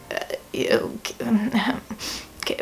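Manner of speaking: hushed, breathy ew under the breath; grossed-out whisper. Real, not acted. Reviewer whispering to viewers, grossed out and hushed. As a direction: whisper your disgust like a secret.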